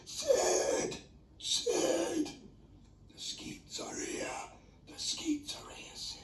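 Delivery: whispered shout, pitched high. Retold or imitated. Urgently whisper-shouting to Sid that coins are here. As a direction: call out urgently but in a whisper. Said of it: "I was shouting but whispering".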